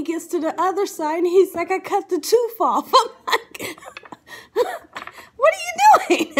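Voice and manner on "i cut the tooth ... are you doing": high words collapsing into laughter; cracking up. Real, not acted. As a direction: retell it through a laughing fit. Retelling while cracking up.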